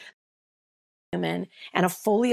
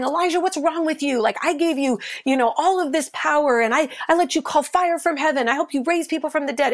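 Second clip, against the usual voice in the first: scolding God; 7 semitones higher, 3 dB louder, faster than usual. Retold or imitated. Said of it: "Instead of going".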